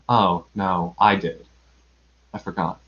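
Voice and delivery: low, sheepish realization; pensive Pooh. A narrator's reading. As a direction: let it sink and fade on the admission. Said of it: "he thought a little and said".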